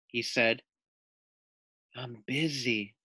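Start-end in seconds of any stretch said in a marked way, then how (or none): 1.9-2.9 much softer lazy lizard voice; sleepy lizard. Acted. Lizard tells Layla softly he is busy. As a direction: murmur it, barely bothered.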